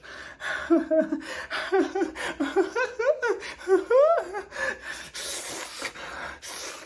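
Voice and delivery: long, high-pitched mock laugh; Garcelle's reaction. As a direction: imitate a drawn-out squealing giggle. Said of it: "that was Garcelle".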